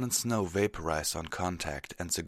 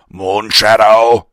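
slow, huge battle bellow; 4 semitones higher, 20 dB louder, slower than usual. Acted. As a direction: bellow the command. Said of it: "Terrado heard Fenrir bellow".

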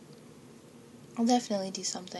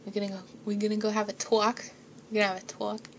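put-on light Boston accent, fast, questioning; quick mock Boston accent with rising ends; Mark Wahlberg; 3 dB louder, faster than usual. Imitating a Boston tough guy for her listeners. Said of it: "like a Boston accent, like Mark Wahlberg".

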